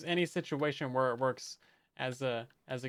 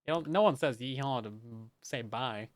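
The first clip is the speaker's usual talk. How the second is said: ordinary talk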